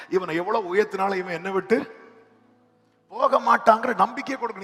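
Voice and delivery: usual speaking voice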